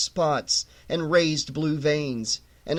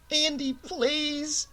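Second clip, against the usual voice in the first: higher, slowed faraway plea; distant woman calling; 8 semitones higher, 4 dB quieter, much slower than usual. A narrator's reading. Gene calls Andy from outside the dream, distant and high. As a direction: call him back from far away.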